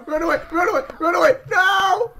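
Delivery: high, loud wailing shouts; hammed-up terrified child; panicked screaming. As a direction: build from frantic warnings to wailing. Panics as monster nears.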